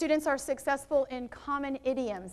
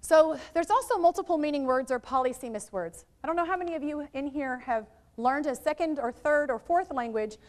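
ordinary talk

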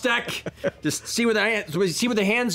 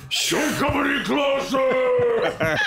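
slow, higher booming shout; magically amplified voice; 7 semitones higher, much slower than usual. Pretend. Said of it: "I use Thaumaturgy".

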